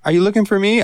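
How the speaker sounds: mock coworker voice.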